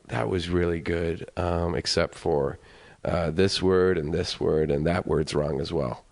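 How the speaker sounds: low, slow voiced critique; measured coach picking faults, lightly imitated; accent coach. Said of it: "they brought in an accent coach".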